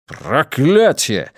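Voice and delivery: slow exclaimed curse; exclaiming curse. A narrator's reading. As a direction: spit out the curse slowly. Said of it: "воскликнул консул".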